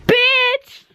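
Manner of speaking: bitch crié très haut et fort; hurle-le pour relever le défi; cri aigu. Real, not acted. Cap ou pas cap de crier bitch. (cri de défi, très aigu)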